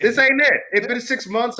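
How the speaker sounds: a comic outburst of being sick of it; fed-up exclamation. Real, not acted. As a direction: blurt it out like you're done with this job. Fed-up rant about job.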